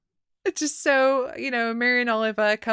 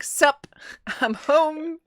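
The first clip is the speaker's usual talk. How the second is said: higher, breezy, offhand greeting; casual homecoming; 7 semitones higher, much slower than usual. Retold or imitated. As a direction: stroll in as if nothing happened. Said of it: "Oliver's like".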